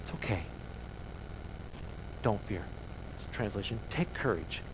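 soft, low, comforting words; softened to near-whisper, comforting. Acted. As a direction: hush them like a frightened child. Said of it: "there's comfort".

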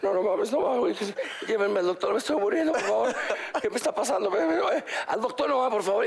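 hammed-up terror of a guy tripping; mock panic. Drugged friend begs the table to take him to the doctor, mock panic.

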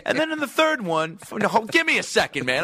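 high, pushy plea for time; worked-up rant. Real, not acted. Comic asking host for a second, ranting. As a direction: press on high, refusing interruption. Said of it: "I got a Jurassic Park rant".

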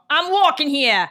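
mock New York tough guy. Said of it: "do a backflip and go".

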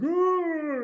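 high mock oh. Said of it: "And he's like".

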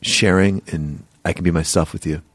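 low, halting mock sincerity; imitated show contestant. Mocking what viewers get on the show, low imitated contestant. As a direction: lightly mimic a contestant, pitched low. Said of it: "is the person going like".

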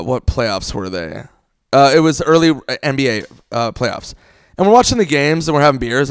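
normal talking voice

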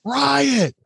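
high-pitched mock alarm yell; one drawn, high cry of alarm; retold yell. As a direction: call it out like a locker-room warning. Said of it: "Somebody in the locker room yells".

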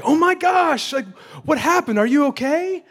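high-pitched, gushing imitation of alarm; mock shocked concern. Sister fretting over a homeless man, mock shocked concern. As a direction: play her aghast, voice way up high.

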